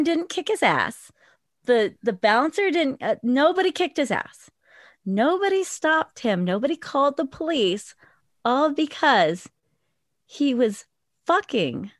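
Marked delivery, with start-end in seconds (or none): none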